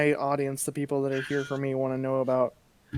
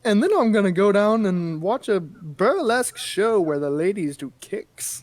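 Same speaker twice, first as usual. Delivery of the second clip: higher, louder start slowing at the end; lightly voiced old-timey gent; 6 semitones higher, 4 dB louder than usual. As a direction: announce it like an old-fashioned gentleman. Continues old-timey man bit.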